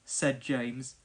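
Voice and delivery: ordinary delivery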